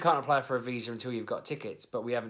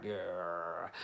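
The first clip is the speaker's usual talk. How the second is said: long, low mock groan; softer, lower growl of resignation; exasperated groan; 4 semitones lower, 6 dB quieter than usual. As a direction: let out a low, drawn-out grumble. Acts out reaction to wife's purchases.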